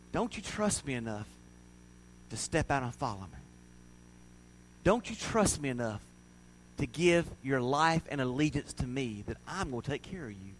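soft, low appeal as Jesus; hushed, reassuring question; gentle, not scolding. Retold or imitated. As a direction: plead quietly with a doubting follower. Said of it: "He wasn't scolding Peter".

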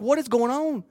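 high-pitched, baffled retelling; bewildered. Retold or imitated. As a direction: voice way up in acted confusion. Acting out bewilderment at wife.

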